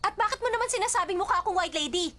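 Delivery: loud, high indignant retort; angry. Acted. Answering someone who likened them to a ghost, angry. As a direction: fire back, offended and heated. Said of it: "Bakit ka ba galit na galit?".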